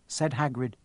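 plain reading voice

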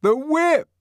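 high, loud mob cry; crowd shout. A narrator's reading. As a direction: answer as a clamouring crowd. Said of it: "answered a hundred voices confusedly".

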